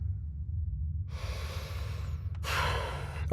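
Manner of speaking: long, breathy exasperated sigh; heavy sigh of frustration; weary sigh. Acted. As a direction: let out a tired, impatient breath. Official overruled, gives up.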